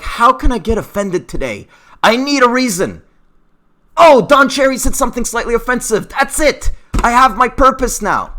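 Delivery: high-pitched parody of the easily offended; mock offended. Vlogger imitating people seeking offence, mocking. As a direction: play an over-eager complainer, voice pushed up.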